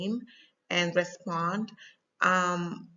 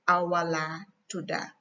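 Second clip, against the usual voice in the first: Gambian-accented Wolof answer, "tuda" with final a. Shown as a demonstration. Teacher modelling the other person's reply, Gambian accent. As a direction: end "tuda" on "ah", Gambian style. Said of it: "tudu and tuda is just accent".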